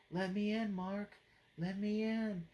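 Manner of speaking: lightly voiced undead friend begging; creepy vampire plea. Dead best friend begging at Mark's window, eerie.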